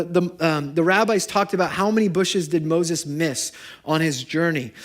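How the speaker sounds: ordinary talk, nothing marked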